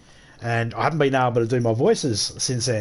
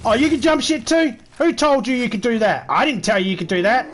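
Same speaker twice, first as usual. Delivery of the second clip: yelling at the zombie; shouted mock scolding; loud; 8 semitones higher, 4 dB louder than usual. Real, not acted.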